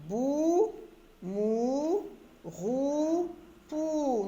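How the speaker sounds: "Bou", "mou", "rou", "pou" drawn out, one by one; elongated vowel. Shown as a demonstration. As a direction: stretch the "ou" in "Bou" through "pou". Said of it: "on allonge un petit peu le son".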